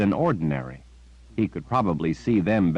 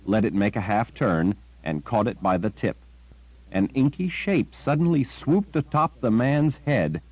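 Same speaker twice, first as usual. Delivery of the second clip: usual speaking voice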